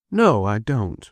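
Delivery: usual speaking voice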